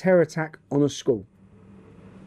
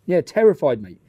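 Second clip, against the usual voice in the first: ordinary talk, nothing marked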